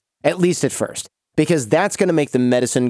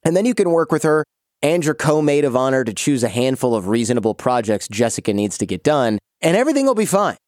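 ordinary talk, nothing marked

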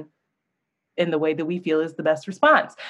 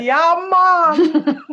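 put-on high, loud, laughing parody of a Caribbean accent; a teasing Caribbean accent through laughter; mocking a bad Caribbean accent; 9 semitones higher, 5 dB louder than usual. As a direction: mimic her botched Caribbean accent. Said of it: "She said".

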